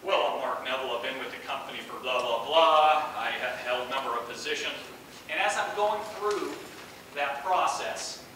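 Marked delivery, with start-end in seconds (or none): 0.0-4.8 lower, stiff self-introduction in a business voice; stiff interview voice. Retold or imitated. Candidate introducing himself to a theatrical interviewer, stiff business voice. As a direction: recite your credentials in a low, formal monotone. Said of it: "I went something like this".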